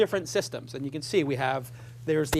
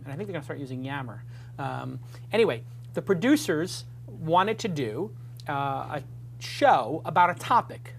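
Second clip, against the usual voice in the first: ordinary delivery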